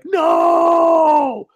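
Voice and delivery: high, long-held cry of refusal; drawn-out shouted no. Retold or imitated. Refusing to love the person everyone loves, high drawn-out shout. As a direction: stretch it out into one high, mock-dramatic shout. His answer about the beloved person.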